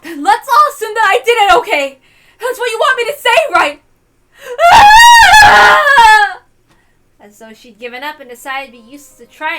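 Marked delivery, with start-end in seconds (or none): 0.0-3.8 high, loud desperate scream. Acted. Natsuhi screams at her accusers, giving up. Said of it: "Natsuhi screamed that out". 4.7-6.4 high, piercing shout of despair; anguished scream. Acted. Cornered Natsuhi cries out in anguish.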